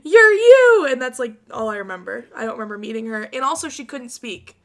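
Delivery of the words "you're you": starstruck. Retold or imitated. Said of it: "And I just go".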